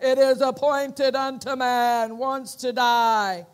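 loud, slow, booming doom voice. Retold or imitated. Preacher imitating Brother Hagin's dramatic voice, loud and slow. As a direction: intone it like a heavenly verdict. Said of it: "He lowers his voice like that".

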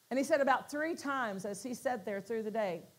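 normal talking voice

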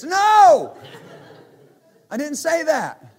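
high, loud indignant yell at the machine; exasperated shout. Retold or imitated. Pastor yelling at Alexa over the wrong music.

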